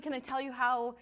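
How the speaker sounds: usual speaking voice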